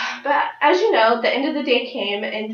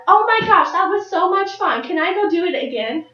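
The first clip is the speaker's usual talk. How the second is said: imitating a thrilled kid, high-pitched and gushing; high, eager gush that opens loud; excited child; 7 semitones higher, 3 dB louder than usual. Seven-year-old begging mom and dad for another ride, imitated excitement.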